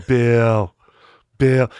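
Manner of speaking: loud, drawn-out call of the name; imitated former boss. Demonstrating how his old boss talked, loud drawn-out impression. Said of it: "he used to talk like this".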